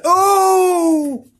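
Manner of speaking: long, high, loud mock wail; one sustained, hammed-up howl of pain; dreadful groan. A narrator's reading. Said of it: "was such a dreadful one".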